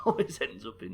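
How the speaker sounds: usual speaking voice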